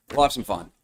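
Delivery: ordinary talk, nothing marked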